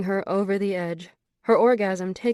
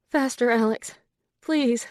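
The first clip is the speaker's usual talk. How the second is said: higher, louder breathless plea; begging; 5 semitones higher, 4 dB louder than usual. A narrator's reading. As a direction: beg him, pitch climbing up. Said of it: "she begged".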